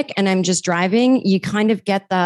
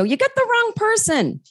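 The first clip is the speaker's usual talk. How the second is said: high, pleading "You got the wrong person"; a lightly acted plea, pitched up; mock protest. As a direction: playfully protest to the mobster. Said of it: "And you go".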